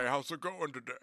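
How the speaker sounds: creepy talking doll voice; lightly voiced creepy doll greeting; female Buffalo Bill voice. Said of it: "it sounded like a female Buffalo Bill".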